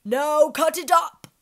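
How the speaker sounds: loud, high command; loud lion order. Acted. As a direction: bark it out.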